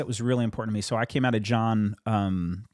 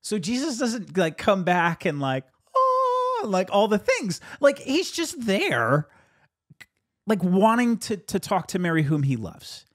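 delighted realization; 7 semitones higher, 3 dB louder than usual. Retold or imitated. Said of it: "And I'm like".

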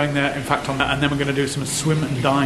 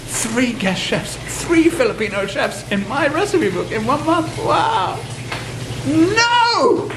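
thrilled disbelief; 11 semitones higher, slower than usual. Pretend. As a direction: build from amazement to a delighted cry. Pretend call, third chef revealed.

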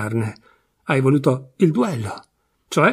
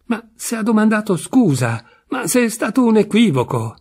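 higher-pitched, heated protest; indignant fury; 7 semitones higher than usual. A narrator's reading. Friend leaping on Memmo with the wife's fury, indignant. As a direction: jump on them with exasperated objection. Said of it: "saltò addosso quasi con la stessa furia".

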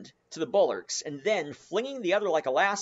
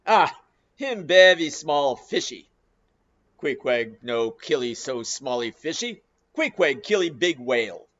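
louder, contemptuous broken English; disdainful; 7 dB louder than usual. Acted. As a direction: dismiss the man as a tiny fish. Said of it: "an unearthly expression of disdain".

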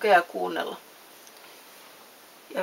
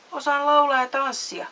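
eager boast; 7 semitones higher, 5 dB louder than usual. A narrator's reading. Puppet proudly answers in story.

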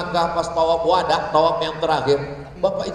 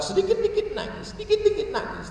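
mock tearful; 14 semitones higher than usual. Preacher imitates someone crying at every little thing, mock tearful. Mocking the newly repentant's easy tears.

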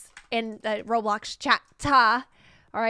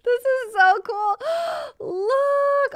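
higher, louder, near tears of joy; thrilled squeal; 12 semitones higher, 6 dB louder, slower than usual. Real, not acted. Streamer finding the pink pool, squealing with joy. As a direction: gasp and squeal at each new find. Exploring birthday build, thrilled.

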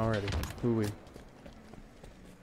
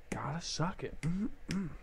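joking whisper, breathy and unvoiced; 3 semitones higher, 8 dB quieter, slower than usual. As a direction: whisper it like a silly secret. Silly whispered chant.

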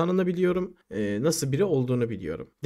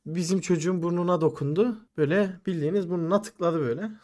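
usual speaking voice

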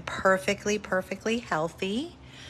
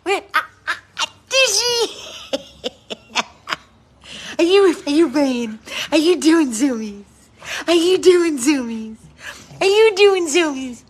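much higher and louder, building glee; 8 semitones higher, 9 dB louder than usual. Real, not acted. Owner squealing at her dog doing zoomies. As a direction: squeal the question at a playing puppy. Playing with dog, high calls.

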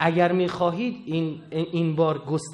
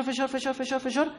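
فشار repeated softly, each word pressed higher; building pressure; 6 semitones higher, 5 dB quieter than usual. Shown as a demonstration. Preacher showing pressure cooker build-up, repeating فشار softly. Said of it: "بذارش تو زودپز".

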